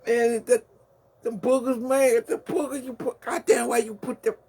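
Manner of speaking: hammed-up high complaining voice; mock disgusted voice. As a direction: play a grossed-out victim shrieking about the boogers.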